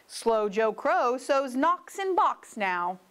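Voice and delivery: ordinary delivery, nothing marked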